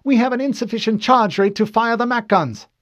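high, loud lieutenant's report; urgent cry. A narrator's reading. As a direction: cry out the problem to the captain. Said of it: "Lieutenant Hikawa cried".

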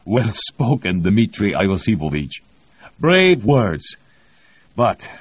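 overjoyed approval, pitch jumping up; delighted grin. A narrator's reading. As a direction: praise him with a huge grin. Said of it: "He broke into a huge grin".

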